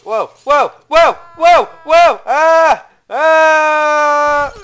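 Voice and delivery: rapid loud whoas building into a long scream; alarmed yelling. Real, not acted. Gamer reacts as he falls, alarmed screaming. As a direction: yell each whoa, then hold the scream.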